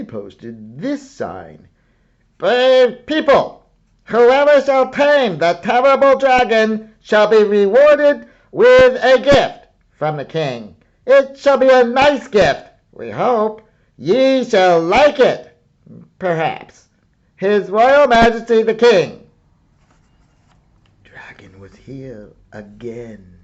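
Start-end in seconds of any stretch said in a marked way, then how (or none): 2.4-19.3 high, loud herald's cry; royal proclamation. Acted. Herald reads the king's sign to the people, loud and high. 21.0-23.4 whisper. A narrator's reading. Narrator whispers the dragon's return to listeners.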